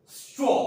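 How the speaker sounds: teacher repeating "स्ट्रॉ" emphatically, sound by sound; modelled reading. Shown as a demonstration. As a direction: draw out sss, then punch ट्रॉ in "स्ट्रॉ". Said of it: "दोबारा सुनिएगा".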